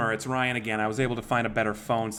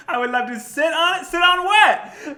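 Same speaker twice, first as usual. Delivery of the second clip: mock outraged echo; 9 dB louder than usual. Mocking the scammer's suggestive line.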